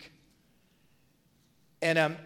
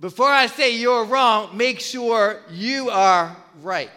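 louder, the second half drawn out very slowly; emphatic scripture quote; 6 dB louder, slower than usual. Shown as a demonstration. Pastor quoting scripture to his church with slow emphasis. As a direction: hammer home the verse, slowing at the end. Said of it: "It says".

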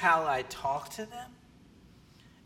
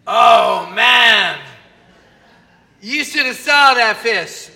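loud, drawn-out bragging fisherman; mock boasting fisherman, loud; boastful fish story; 4 semitones higher, 12 dB louder, much slower than usual. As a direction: shout it like a proud angler.

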